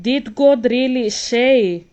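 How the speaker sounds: slow, loud, pointed question; the serpent's question. Acted. Serpent questioning Eve in the garden, slow and loud. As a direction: lean in slowly like the serpent in Eden. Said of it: "That's the snake asking you questions".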